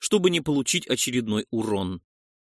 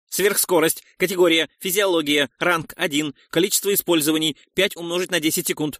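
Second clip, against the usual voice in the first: higher, louder item-by-item system announcement; interface readout; 5 semitones higher, 3 dB louder than usual. A narrator's reading. The neural interface lists an ability to the hero, system readout. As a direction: announce each menu entry, pitched up.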